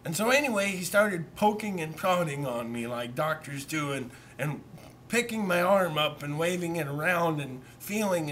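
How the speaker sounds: usual speaking voice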